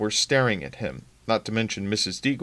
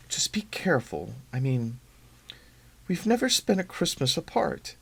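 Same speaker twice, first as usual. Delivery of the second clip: soft near-whisper; hushed worry, almost breathless; murmured; 4 semitones higher, 3 dB quieter than usual. Acted. Wife murmurs to husband on the phone, hushed near-whisper. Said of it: "Lynn murmured".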